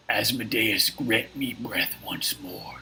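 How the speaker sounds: slow, hoarse whispered roar; breathy monster bellow held back in volume; bellowing fiend. Acted. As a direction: rasp it out slowly like an infernal ogre. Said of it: "you hear it bellow".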